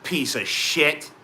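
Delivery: mock outrage at a driver swerving; retold road rage. Cut off by a truck, hammed-up slow outraged yell. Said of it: "I'm like".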